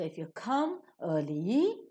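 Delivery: slow, "early" stretched and swept upward; rising tone on early. Shown as a demonstration. Teacher shows the sentence has not ended, rising on "early". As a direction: draw out "early" on a climbing pitch. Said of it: "early will receive a rising tone".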